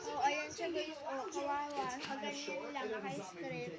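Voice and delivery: hushed. Real, not acted. Showing her hurt little sister to viewers, quiet and hushed.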